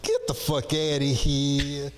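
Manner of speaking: put-on slow, drawn-out Boston tough-guy accent; a mild put-on Boston Guido voice. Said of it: "typical kind of Guido things".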